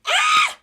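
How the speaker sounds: sudden high excited shriek; excited scream. Real, not acted. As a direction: let out a squeal of pure excitement. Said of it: "Internal screaming".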